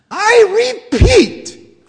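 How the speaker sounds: very high, loud, slow insistent cry; Lorax crying out. Acted. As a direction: drag it out, insisting hard. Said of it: "cried the Lorax".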